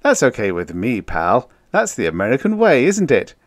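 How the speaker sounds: loud, jovial, climbing at the end; good-natured. A narrator's reading. Joker letting his pal complain, good-natured. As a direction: build up to a high teasing question. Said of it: "Town said good-naturedly".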